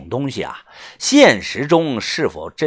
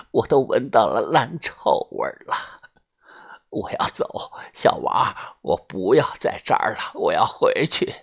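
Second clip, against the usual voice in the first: put-on low, breath-heavy old woman's fright; terrified old woman; 4 semitones lower, 6 dB quieter than usual. A narrator's reading. Old woman revived from fainting insists he died, terrified. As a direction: protest in horror, voice mostly breath. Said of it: "老太太惊恐的大声说".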